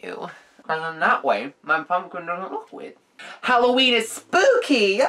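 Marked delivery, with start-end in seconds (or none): 3.4-5.0 excited. Real, not acted. Cheerful exclaim.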